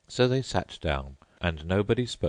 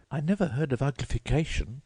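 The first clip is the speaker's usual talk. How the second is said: put-on much higher polite girl voice; Alice's voice; 10 semitones higher, faster than usual. A narrator's reading. Alice thanking the Mock Turtle, high and polite. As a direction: pitch up into a polite young girl.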